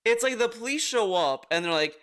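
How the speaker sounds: ordinary talk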